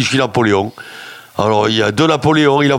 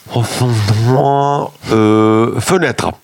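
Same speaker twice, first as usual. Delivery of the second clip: very slow, dragged-out reply; patient slowly answering the test; slow dim answer; 3 semitones lower, much slower than usual. Retold or imitated. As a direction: drag out each word like a dimwit.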